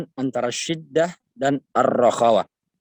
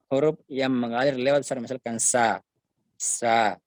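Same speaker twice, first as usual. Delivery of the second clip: ordinary talk, nothing marked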